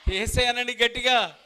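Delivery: loud, high cry of Yesayya; loud call. Real, not acted. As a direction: shout the name to lead the crowd. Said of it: "గట్టిగా అనండి". (గట్టిగా యేసయ్యా అని పిలుపు)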